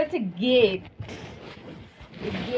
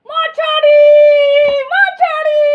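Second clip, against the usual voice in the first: high, loud shout; 13 semitones higher, 13 dB louder, much slower than usual. Real, not acted. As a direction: yell the word out excitedly. Shouting the word.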